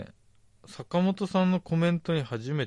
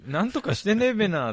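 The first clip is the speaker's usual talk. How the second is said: Tochigi ねえべな said higher, falling at the end; hamming up the Tochigi べな, pitched up; Tochigi dialect; 7 semitones higher, 4 dB louder than usual. Retold or imitated. Repeating the Tochigi phrase with a laugh, hammed-up Tochigi accent. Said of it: "って言いますよね".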